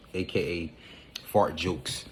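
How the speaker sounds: ordinary delivery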